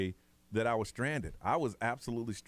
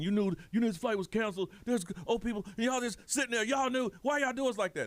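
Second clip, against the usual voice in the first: angry passenger; 9 semitones higher than usual. Retold or imitated. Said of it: "the lady's like".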